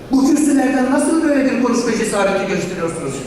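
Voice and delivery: ordinary delivery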